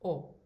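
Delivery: "o" as a pure closed o, no glide; rounded closed "o". Shown as a demonstration. Teacher showing students the closed O, pure "o". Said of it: "closed O".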